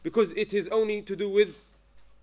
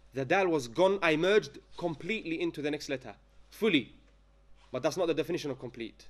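usual speaking voice

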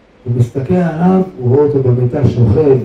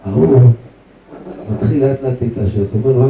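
usual speaking voice

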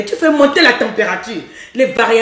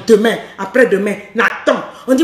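ordinary talk, nothing marked

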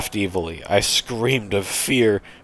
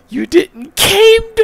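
very high, loud, slow echo; incredulous repeat; 7 dB louder, slower than usual. Real, not acted. Reader baffled by the bad grammar, incredulous shriek. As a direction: shriek the question in disbelief at CAMED.

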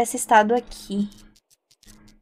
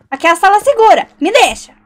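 frantic plea; 8 semitones higher, 8 dB louder than usual. Real, not acted. Fleeing ghost into safe room.

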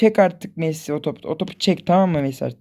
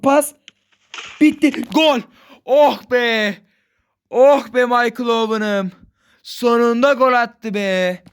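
goal relief; 4 semitones higher, slower than usual. Real, not acted. Gamer celebrating a last-minute goal, excited then relieved. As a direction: celebrate the late winner. Finally scoring the winning goal.

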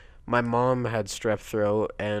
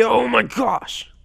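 slow, high, loud cry; alarmed exclamation; 12 semitones higher, 6 dB louder, slower than usual. Real, not acted. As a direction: stretch it out in disbelief. Ride goes wrong.

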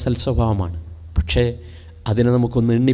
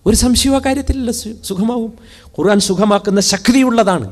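preaching at full force; 9 semitones higher, 3 dB louder than usual. Acted. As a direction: proclaim the verse to the hall. (ഉച്ചത്തിൽ പ്രസംഗം)

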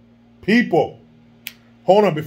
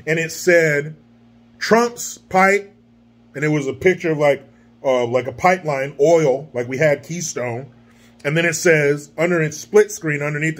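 usual speaking voice

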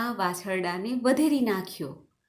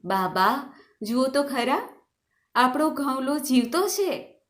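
excited discovery; 6 semitones higher, 3 dB louder than usual. A narrator's reading. Daughter-in-law tells mother-in-law the calf lives, excited. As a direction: voice climbing higher with excitement.

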